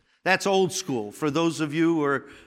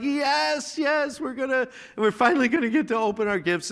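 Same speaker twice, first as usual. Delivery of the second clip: put-on high, eager children's cheer; excited kids; 8 semitones higher than usual. Kids on Christmas morning cheering about gifts, excited.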